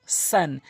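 সেন with wide mouth and thin s; spread light s. Shown as a demonstration. Teacher contrasts two letters, light spread সেন. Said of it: "সিনটি মুখ ওসার হবে".

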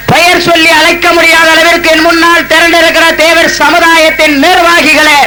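rising, ringing rally address building to shouts; thunderous political oratory. Real, not acted. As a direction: hail the crowd like a stage orator. Rousing greeting to the crowd. (முழங்கும் அரசியல் மேடைப் பேச்சு)

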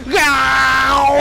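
long high roaring yell; battle roar. Real, not acted. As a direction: let out a drawn-out victory roar. Boss beaten, long roar.

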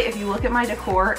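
usual speaking voice

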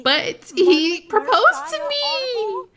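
high, drawn-out gushing squeal; excited squeal. Acted. Neighbor gossiping to a friend about a proposal, squealing. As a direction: stretch the big news into a high squeal.

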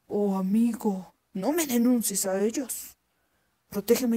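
lento, suplicando con un toque de miedo; súplica asustada. Acted. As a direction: ruega despacio, asustado. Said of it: "le suplicó el zorro, todo asustado". (el oso suplica al campesino, lento y algo asustado)

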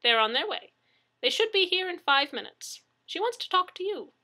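high, lilting urgent delivery; brisk worried neighbor. A narrator's reading. Neighbor handing phone to victim, brisk and urgent. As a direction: pass the news on in a high voice. Neighbor relays dispatcher's news.

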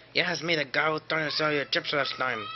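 lightly voiced chip seller; Jose voice. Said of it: "It's me, Jose".

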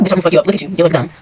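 ordinary delivery

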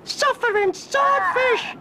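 cried out. Acted. Ross crying out at Cromarty's spots, squeaky. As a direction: yelp in shock like a cartoon boy. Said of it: "cried Ross".

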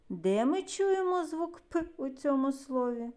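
ordinary talk, nothing marked

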